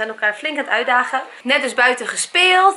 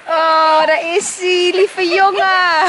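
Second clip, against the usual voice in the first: doggy talk; 5 semitones higher, 4 dB louder, much slower than usual. Real, not acted. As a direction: coo at the dog lovingly. Greeting beloved dog.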